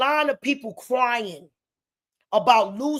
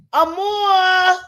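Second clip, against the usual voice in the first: higher, loud long call of the name; 7 semitones higher, 7 dB louder than usual. Real, not acted. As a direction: call Amor across the room. Calling child's name.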